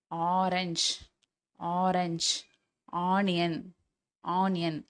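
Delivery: "ஆரஞ்ச்", "ஆனியன்" with a long "aa" start; long ஆ demo. Shown as a demonstration. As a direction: teach "ஆரஞ்ச்" and "ஆனியன்" singly, drawing out the "aa". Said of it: "இது எல்லாமே ஆ". ("ஆரஞ்ச்", "ஆனியன்" நீண்ட ஆ ஒலியுடன்)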